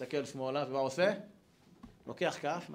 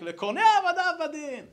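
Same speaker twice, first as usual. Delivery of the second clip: crying out; 12 semitones higher, 10 dB louder than usual. Acted. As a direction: cry the verse out as if waking from a trance. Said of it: "הוא מתעורר וצועק".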